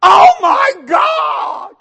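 high, very loud, drawn-out shriek; mimicked amazed screaming. Homeowners see their new house, hammed-up amazed shout. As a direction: shriek each word long, imitating overwhelmed people. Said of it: "they all do the same thing".

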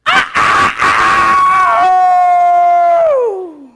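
very loud, high crowing cry; rooster-like crow. Retold or imitated. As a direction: crow in bursts, then hold the last one long. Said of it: "you can crow".

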